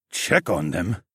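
ordinary delivery

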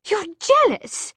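high, loud, slow shriek; shrieking accusation. Acted. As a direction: hurl the accusation. Jessamine accuses Tessa.

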